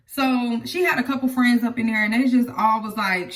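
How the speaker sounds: ordinary talk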